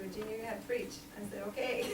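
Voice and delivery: normal talking voice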